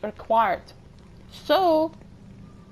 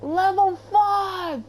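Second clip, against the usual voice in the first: higher, slower, louder cry of disbelief; astonished cry; 7 semitones higher, 4 dB louder, slower than usual. Real, not acted. Streamer seeing a level five lock, astonished. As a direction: stretch it out in shock.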